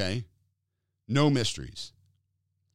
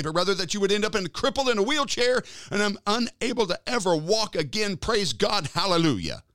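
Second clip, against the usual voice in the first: higher, louder sarcastic tirade; hammed-up indignant preacher; mock outrage; 6 semitones higher, 4 dB louder than usual. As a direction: play the outrage to show the absurdity. Mock rant to sick woman.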